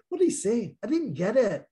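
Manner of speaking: high, puzzled foreign listener; high-pitched mock confusion; confused listener. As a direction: play a baffled outsider who didn't catch it. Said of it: "some are listening right now and they're".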